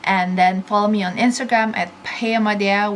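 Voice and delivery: ordinary talk, nothing marked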